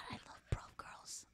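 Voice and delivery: unvoiced whisper. Real, not acted. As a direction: breathe it as a whisper. Whispered aside.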